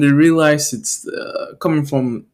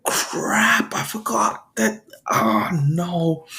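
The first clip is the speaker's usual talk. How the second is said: high, strained and softer; dismayed groan; 3 semitones higher, 6 dB quieter, slower than usual. Acted. Hero whose secret is exposed, dismayed. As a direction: whine it as the secret slips out.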